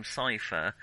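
ordinary delivery

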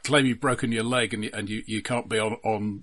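normal talking voice

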